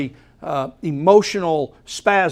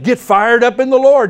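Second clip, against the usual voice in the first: higher, louder pep-rally cry; mock hype; 5 semitones higher, 6 dB louder than usual. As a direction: fake fired-up cheerleader. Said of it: "He's not saying".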